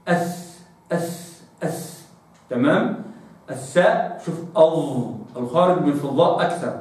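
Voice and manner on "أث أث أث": أث breathed out soft and unvoiced; whispered th drill. Shown as a demonstration. Teacher drilling the sound, whispered أث. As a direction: repeat أث as a whispered hiss. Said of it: "هكذا".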